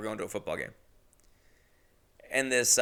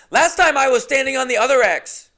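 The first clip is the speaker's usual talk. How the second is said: put-on high, loud little boy voice; a squeaky know-it-all nine-year-old; bratty kid voice; 13 semitones higher, 12 dB louder than usual. The kid correcting the casting director, loud and high.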